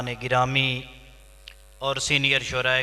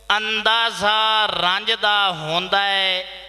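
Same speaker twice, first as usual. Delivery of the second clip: higher-pitched, declaimed mushaira verse; poetry recital; 7 semitones higher, slower than usual. Acted. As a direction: declaim each line to the audience, pausing between phrases. (मुशायरे विच उच्ची सुर नाल शेर)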